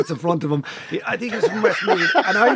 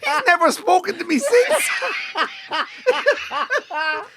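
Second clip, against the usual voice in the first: laughing; 9 semitones higher than usual. Real, not acted. Laughing at his own story.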